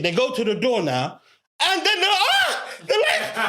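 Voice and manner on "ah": very high laughing yelp; laughing yelp of mock fright; mock gasp. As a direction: squeak it out, half laughing. Retold shock at the door.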